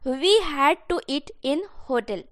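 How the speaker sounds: teacher dictating the English translation; slow dictation. Shown as a demonstration. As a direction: lay each word down slowly for students. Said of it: "हमें hotel में खाना पड़ता था".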